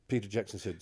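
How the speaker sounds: normal talking voice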